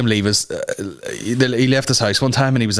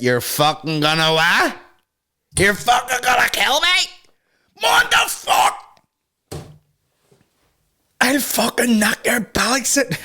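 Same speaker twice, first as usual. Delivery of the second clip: much higher, slow, incredulous Belfast squeal; shrieking Belfast reply; 13 semitones higher than usual. Retold or imitated. Answering a threat, a shrieking incredulous Belfast squeal. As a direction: squeal it out like a Lurgan hard case. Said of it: "you'd be like".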